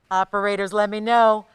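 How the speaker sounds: loud call across the studio. Real, not acted. Host to studio operators, a loud call-out. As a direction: shout the question to the operators.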